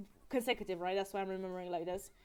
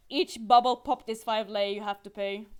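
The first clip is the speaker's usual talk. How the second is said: mock-stern announcement, firm and louder; very serious; 8 dB louder than usual. Man at the waffle place charging for popped bubbles, mock serious. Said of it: "he says very seriously".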